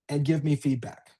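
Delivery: usual speaking voice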